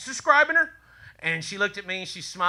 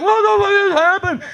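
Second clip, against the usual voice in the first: shocked laughing yell; 12 semitones higher, 6 dB louder than usual. Retold or imitated. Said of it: "We were like".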